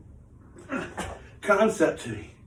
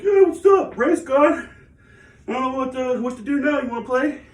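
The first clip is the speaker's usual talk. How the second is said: much higher, louder goofy dog voice; voicing the dog; 8 semitones higher, 4 dB louder than usual. Vlogger voicing his dog Noodle, high and carefree. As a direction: play the oblivious pup who lost his friend. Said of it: "Noodle's just like".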